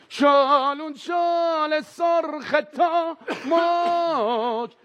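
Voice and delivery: very slow, stretched high shout of gibberish; stoned nonsense shout. Retold or imitated. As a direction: drag the nonsense out in a high yell. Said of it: "فری گفت".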